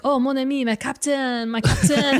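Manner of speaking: put-on a light French accent, fond and rising; lightly put-on French accent, calling her my captain. The professor fondly calling Monica his captain, in a light French accent. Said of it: "I'm assuming he speaks in a French accent".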